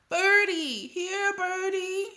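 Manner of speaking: put-on high toddler voice calling birds; little boy calling. A narrator's reading. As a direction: coax the birds in a high, drawn-out call. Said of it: "he called".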